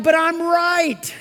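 high, slow, whiny protest; mock indignant. Preacher mimics a listener insisting he is right, high whiny protest. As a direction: play the stubborn know-it-all. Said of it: "But you're like".

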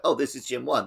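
usual speaking voice

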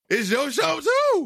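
high laughing shout. Real, not acted. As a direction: yell it up high, teasing. Teasing cohost after Bulls loss.

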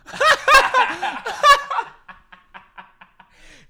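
shrieking burst of laughter; loud high laugh. Real, not acted. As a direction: explode into a high wheezing laugh.

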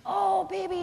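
high, cooing sympathy; motherly comfort. Retold or imitated. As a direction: croon to a hurt little one. Mother scooping up her crying child.

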